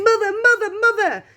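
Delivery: put-on high, loud boy's shout; excited shouting. A narrator's reading. Charlie bursts through the front door to his mother, excited shouting.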